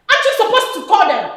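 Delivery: normal talking voice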